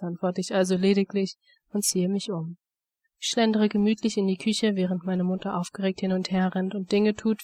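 ordinary delivery

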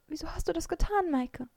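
pitched high, wobbling toward tears; close to tears. A narrator's reading. Mara asks Maike why, close to tears. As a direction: ask it almost crying. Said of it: "Sie war den Tränen nahe".

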